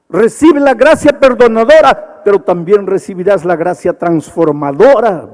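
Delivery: high, loud altar-call plea rising to a cry; full-force revival preaching; fervent preaching. Acted. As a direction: plead with the crowd to come forward.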